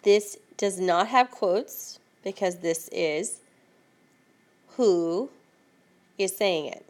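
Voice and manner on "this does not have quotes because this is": ordinary talk, nothing marked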